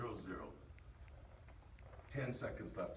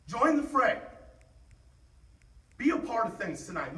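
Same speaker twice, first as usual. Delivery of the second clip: loud, high, fired-up shouting; halftime pep speech; 14 dB louder than usual. Real, not acted. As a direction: fire up the team at full force.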